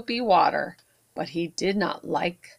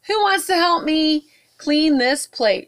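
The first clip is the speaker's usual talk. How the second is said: Ruby's voice; 8 semitones higher, 4 dB louder than usual. Acted. Ruby voiced in read-aloud story.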